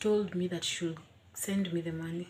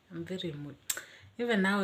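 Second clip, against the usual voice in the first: usual speaking voice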